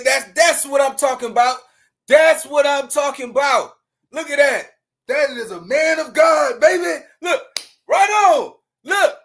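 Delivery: loud, hyped shouting; excited cheering. Real, not acted. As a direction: hype him up like a fan at a game. Cheering the interviewee on camera.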